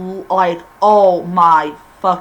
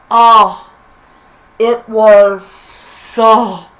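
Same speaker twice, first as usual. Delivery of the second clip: ordinary talk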